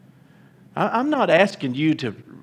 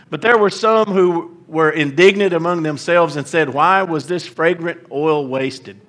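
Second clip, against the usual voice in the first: louder, lifted preacher's reading of scripture; 3 semitones higher, 6 dB louder than usual. Acted. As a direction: proclaim the passage to the congregation. Reads scripture aloud.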